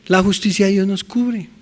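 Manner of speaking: ordinary delivery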